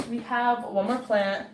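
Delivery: ordinary talk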